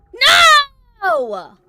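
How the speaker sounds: drawn-out high yell; loud yell. Real, not acted. Streamer answering viewers over the game volume, loud yell. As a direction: yell no at the top of the voice. Yelled outburst while checking volume.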